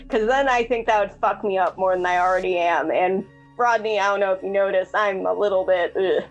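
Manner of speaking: ordinary delivery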